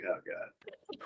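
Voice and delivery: strangled whisper from a held-in hiccup; choked near-whisper; suppressed hiccup voice. Retold or imitated. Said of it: "your voice just changes".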